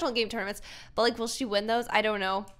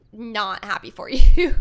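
laughing; 14 dB louder, slower than usual. Real, not acted. Scolding Katie for befriending cheater.

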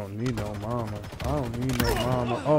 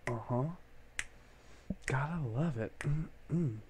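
ordinary talk

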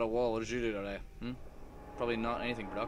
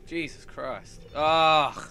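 ordinary talk

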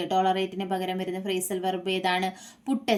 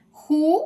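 "who" much higher and louder; stressed answer word; 8 semitones higher, 9 dB louder than usual. Shown as a demonstration. Teacher to students, the missing pronoun "who" shouted out. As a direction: punch out "who" alone. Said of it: "എന്ത് pronoun ആണ് use ചെയ്യുന്നത്".

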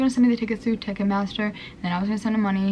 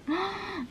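sharp gasp; 10 semitones higher, 9 dB quieter than usual. Real, not acted. Remembering the scam.

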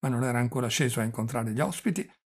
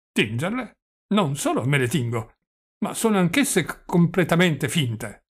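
put-on raised, loud old man with a stutter; doddering old prince, boastful; 4 semitones higher, 5 dB louder than usual. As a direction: boast proudly, tripping over the big reveal. (vecchio principe fiero che balbetta)

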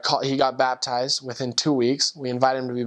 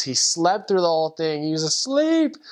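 re-enacted disbelief, climbing high at the end; mock-astonished, pitch leaping up; incredulous; 4 semitones higher than usual. Missionary telling his companion the man slept, incredulous.

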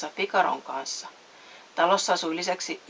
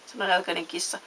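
usual speaking voice

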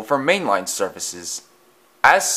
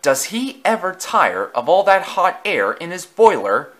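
higher, mocking aside; wry little engine; 8 semitones higher, 4 dB louder than usual. A narrator's reading. Thomas mocking the boastful engine to Edward. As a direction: tease the show-off. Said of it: "Thomas remarked to Edward".

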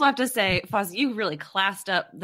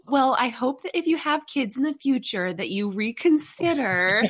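higher-pitched, politely disapproving letter voice; nice little letter; 6 semitones higher than usual. Pretend. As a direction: gently imitate a too-nice mom's letter. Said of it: "send you a nice little letter saying".

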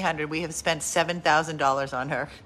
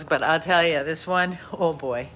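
ordinary talk, nothing marked

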